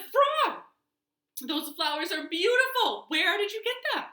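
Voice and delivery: high, loud delighted Toad; excited admiration. A narrator's reading. Toad admiring Frog's bouquet, excited admiration.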